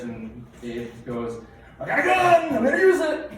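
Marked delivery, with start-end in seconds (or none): 1.8-3.3 high, loud hammed-up yelling of a startled dad; mock panicked shout. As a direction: bellow it like you just woke to a burglar.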